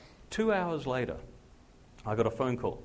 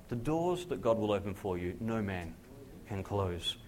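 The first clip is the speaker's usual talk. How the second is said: ordinary talk, nothing marked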